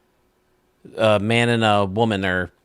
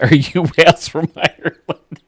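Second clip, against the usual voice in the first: laughing through the punchline; 7 semitones higher, 7 dB louder than usual. Real, not acted. Bartender's punchline, laughing through it. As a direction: crack up as you say it.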